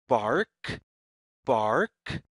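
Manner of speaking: plain reading voice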